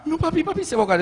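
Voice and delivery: put-on a scared little girl; frightened child. Daughter insisting to father the boy will drown, frightened.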